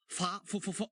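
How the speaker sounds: ordinary delivery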